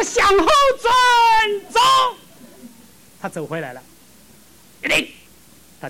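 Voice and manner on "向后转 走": very high, loud drill shout; about-face command. Retold or imitated. Soldier ordering the officer to turn back, loud drill shout. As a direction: shout the turn before the lake.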